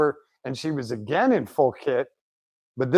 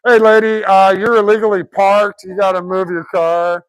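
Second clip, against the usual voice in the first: loud, high mock-official call; playful bullhorn order, easing slightly toward the end; imitated PA announcement; 7 semitones higher, 9 dB louder than usual. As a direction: boom it out as if over a loudspeaker. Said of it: "he gets on the P A".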